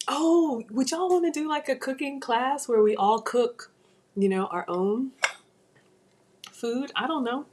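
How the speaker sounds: excited. Real, not acted. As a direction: pitch it up, eager. Excited idea to viewers.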